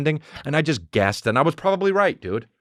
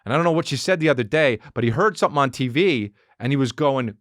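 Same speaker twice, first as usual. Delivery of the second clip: normal talking voice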